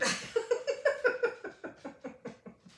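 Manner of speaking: long high unvoiced laughter; breathy laugh. Real, not acted. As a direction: wheeze with laughter.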